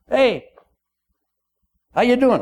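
lightly voiced jovial king. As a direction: greet him like a jovial king.

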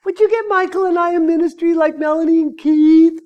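high, acted plea to heaven; mock-earnest prayer, held up high; pleading prayer. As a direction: beg the Lord in a hammed-up high voice. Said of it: "asking the Lord".

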